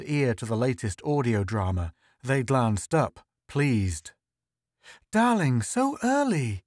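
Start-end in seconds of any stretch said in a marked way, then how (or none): 5.1-6.6 high-pitched, delighted wifely greeting; delighted cry. A narrator's reading. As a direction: cry out happily in a much higher voice. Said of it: "she cried".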